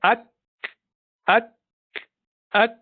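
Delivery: ak with a crisp k at the end; showing the kaf articulation; kaf demo. Shown as a demonstration. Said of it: "you pronounce the letter kaf".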